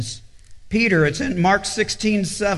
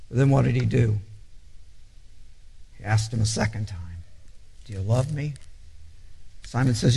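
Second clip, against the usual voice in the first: tender hushed retelling; 9 semitones lower, 6 dB quieter than usual. Acted. Preacher softly retelling Jesus asking Peter, hushed and tender. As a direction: draw the congregation close with a quiet voice.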